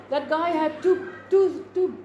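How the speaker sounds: ordinary talk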